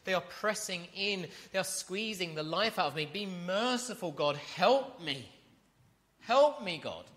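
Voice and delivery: voicing David's frantic plea for help; desperate plea. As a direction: cry out to God, hunted and begging. Preacher voicing David's desperate prayer.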